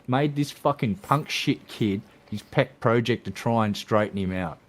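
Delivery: ordinary delivery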